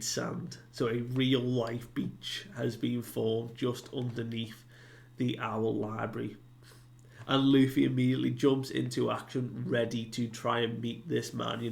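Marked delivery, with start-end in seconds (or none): none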